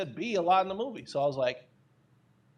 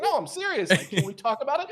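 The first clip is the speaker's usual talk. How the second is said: much higher, laughing through a mock serious plea; laughing insistence; 7 semitones higher, 4 dB louder than usual. Insisting on the crude joke to friends, laughing. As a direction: pretend earnest while cracking up. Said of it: "And I was like".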